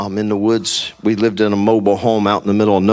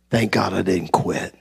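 ordinary talk

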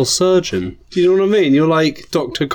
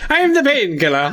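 hammed-up high Irish wrestler intro; drawn Irish; 9 semitones higher than usual. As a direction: play it as a jaunty Irish leprechaun. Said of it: "Like a drawn Irish".

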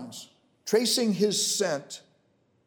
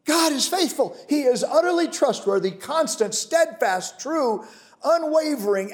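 fervent preaching; 7 semitones higher, 5 dB louder than usual. Real, not acted. Preacher proclaiming God's faithfulness to the congregation, fervent. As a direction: preach it out with full conviction.

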